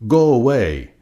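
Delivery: loud, high angry shout; grouchy shout. A narrator's reading. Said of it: "shouted the grouchy ladybug".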